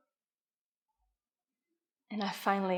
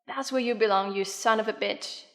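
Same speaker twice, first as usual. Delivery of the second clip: louder, emphatic retold taunt; scornful jeer; 2 semitones higher, 5 dB louder than usual. Grown child quoting Mom's taunt to Dad, loud scornful jeer. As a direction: retell a scolding jab, raising the volume. Retelling a parent's jeering outburst.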